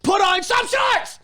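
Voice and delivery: high, slow mock shout; mock yell. Host yelling at a cyclist in a tight suit, slow mock shout. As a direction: bellow it at the cyclist across the park.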